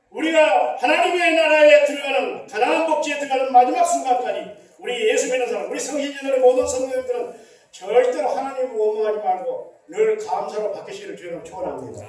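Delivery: loud, fervent closing blessing; preaching blessing. Real, not acted. Pastor blessing the congregation at the close, full-force preaching. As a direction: call it out over the congregation.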